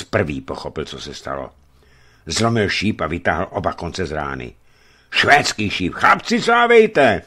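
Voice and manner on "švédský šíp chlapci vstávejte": loud call rising high and drawn out; alarm cry. A narrator's reading. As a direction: cry out to wake the men. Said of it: "zvolal a chtěl vyběhnout". (hlasité poplašné zvolání)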